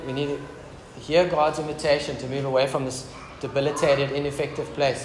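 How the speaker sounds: ordinary talk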